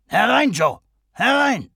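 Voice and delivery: laut und hoch hereinrufen; ruft herein. A narrator's reading. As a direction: ruft zur Tür hinüber. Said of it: "rief er". (Henchy ruft Joe an der Tür laut herein)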